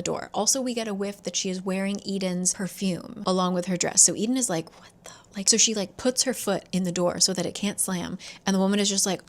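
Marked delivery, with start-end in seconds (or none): 4.8-5.1 whispered, cut-off disbelief; stunned whisper. Retold or imitated. Eden as the woman tries to shut her out, stunned whisper. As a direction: whisper it as if lost for words. Eden speechless at slammed door.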